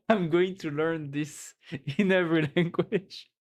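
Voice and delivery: talking through giggles; amused at own idea; laughing. Real, not acted. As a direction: chuckle your way through it.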